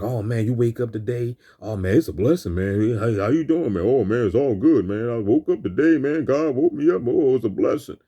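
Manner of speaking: a hint of a brainwashed, upbeat voice; mock cheerful "it's a blessing" voice; mocking cheerful churchgoer. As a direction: lightly parody the blessed-and-grateful crowd. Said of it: "we was programmed to be like".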